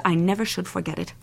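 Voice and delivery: usual speaking voice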